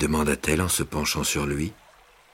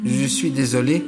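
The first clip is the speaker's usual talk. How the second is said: awkward, higher call of apology; a young man's flustered cry; clumsy cry; 8 semitones higher than usual. Acted. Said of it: "il cria d'une voix maladroite".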